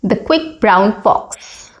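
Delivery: slow, each word separate; each word clearly. Shown as a demonstration. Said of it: "focusing on each word clearly".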